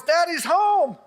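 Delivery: high-pitched, mock homecoming holler; imitated happy yell. Son imitating Dad coming in the house, yelling. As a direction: call it out high, like Dad announcing himself. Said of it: "he always yells".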